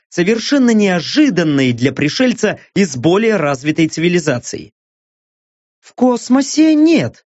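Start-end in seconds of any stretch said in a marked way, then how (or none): 5.8-7.3 high, drawn-out female voice; woman character's reply. A narrator's reading. Гостья из будущего answering Стриж, high-voiced. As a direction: answer pitched well up, slowly. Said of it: "отозвалась та".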